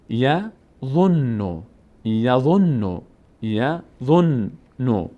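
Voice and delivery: یظنو very slow, n held double; slow doubled n. Shown as a demonstration. Teacher slowly models یظنو with doubled n.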